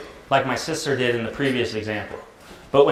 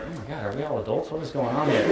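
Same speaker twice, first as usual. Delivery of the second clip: exasperated husband; 7 dB quieter than usual. Retold or imitated. Husband reacting to squabbling adults, quiet exasperation. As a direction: grumble quietly like a fed-up husband. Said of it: "And her husband's like".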